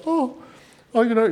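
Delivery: high, wobbly imitation of a teary wife; hammed-up sniffly oh; mock crying wife. Imitating his teary wife over a sad dog video, high and mock-sobbing.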